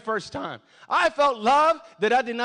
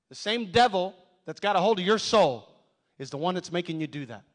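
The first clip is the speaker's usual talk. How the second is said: usual speaking voice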